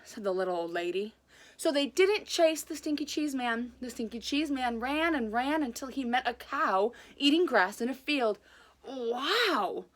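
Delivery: lively read-aloud storytelling voice; animated children's story reading; story narration. A narrator's reading. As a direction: read it like a picture book to kids.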